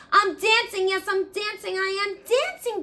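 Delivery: high, loud, happy burst of joy; joyful cry. Acted. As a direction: cry out with delight at dancing. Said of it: "Gerald cried".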